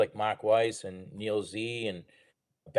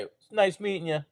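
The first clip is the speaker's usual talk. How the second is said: higher, overly polite sing-song; polite brush-off; 9 semitones higher than usual. Shown as a demonstration. Advice for a fan to brush off a rude star, overly polite. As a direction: sweetly close the conversation. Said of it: "just say".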